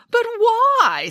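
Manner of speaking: high, baffled exclamation; incredulous. A narrator's reading. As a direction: demand a reason in disbelief. Nanny incredulous at the confession.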